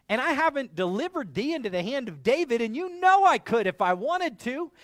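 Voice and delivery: a general insulted and bristling; angry retort. Retold or imitated. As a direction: Abner rebuking the king, building in volume. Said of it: "angrily addresses him".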